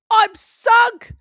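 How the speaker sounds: very high, loud wail; despairing cry. A narrator's reading. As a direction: wail as he sinks. Thomas falls in hole.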